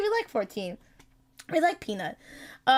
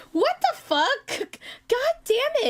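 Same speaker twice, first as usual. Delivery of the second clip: shocked squeal; 12 semitones higher, much slower than usual. Real, not acted.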